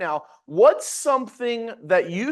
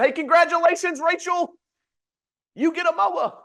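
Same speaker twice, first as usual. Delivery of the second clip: mock-cheery sales boss, pitched way up; excited boss; 11 semitones higher, 3 dB louder than usual. Sales leader congratulating an agent, mock excited and high. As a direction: announce it like big exciting news. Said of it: "sales leader called you tomorrow and said".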